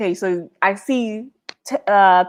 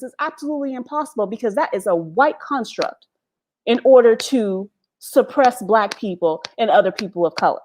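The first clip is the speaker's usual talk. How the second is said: ordinary talk, nothing marked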